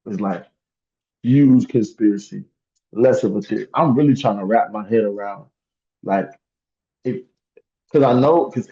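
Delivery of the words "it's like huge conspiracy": ordinary delivery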